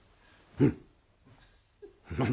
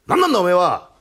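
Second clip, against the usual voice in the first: loud, raised demand; indignant challenge; 5 semitones higher, 11 dB louder than usual. Acted. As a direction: confront the stranger loudly. 侵入者と住人の口論. (大声で食ってかかる)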